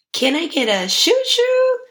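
high, sing-out catchphrase; playful call-out. Pretend. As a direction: call it out high like a train whistle. Show catchphrase after tearful segment.

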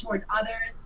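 usual speaking voice

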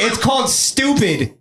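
ordinary delivery

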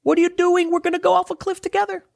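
hammed-up frantic cry; mock scream. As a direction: shriek a warning at the backward-facing riders. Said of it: "you're screaming in their face".

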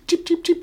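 squeaky high toop sounds; high hopping noises. Acted. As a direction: chirp the hops up high. GM voices the creature hopping.

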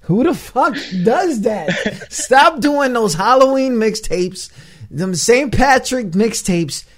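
loud, high-pitched comic outrage; exasperated rant. Real, not acted. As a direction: rant like you are totally fed up. Mock rant at gimmicky mixtapes.

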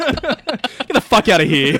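loud, high scoff broken by laughter; laughing dismissal. Real, not acted. Co-host scoffing at a friend's complaint, loud and laughing. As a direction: dismiss it at the top of your voice, laughing.

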